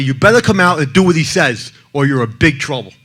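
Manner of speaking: loud, threatening mother's yell. Retold or imitated. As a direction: shout the threat at the hidden boy. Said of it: "My mother's yelling at me".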